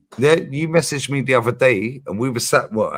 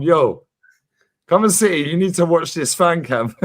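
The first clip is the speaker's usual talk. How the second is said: excited dad; 5 semitones higher than usual. Retold or imitated. Dad quoted telling mum.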